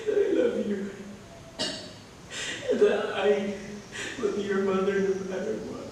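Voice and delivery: soft, weak farewell between coughs; a faint, fading goodbye; dying mother. Acted. Dying mother to her son, weak and soft. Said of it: "and said, coughs".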